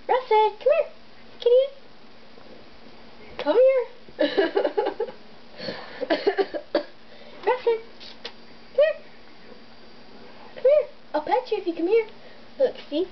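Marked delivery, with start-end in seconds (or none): 0.1-8.9 high sing-song call to a cat; calling a lost pet. Real, not acted. 10.5-12.1 high sing-song call to a cat; calling a lost pet. Real, not acted.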